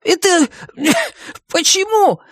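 high protest broken by a cough; indignant, coughing little hero; coughing indignation. A narrator's reading. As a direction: splutter the question through smoke, very high. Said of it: "кашляя и чихая, возмутился Бартоломео".

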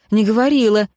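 usual speaking voice

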